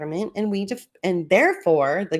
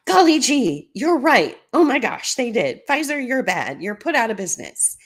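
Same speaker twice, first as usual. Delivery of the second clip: high, loud gasp of feigned discovery, easing off; hammed-up shocked realization, starting high and emphatic; mock astonishment; 6 semitones higher, 6 dB louder than usual. Government feigning surprise to the lawyers, in mock astonishment.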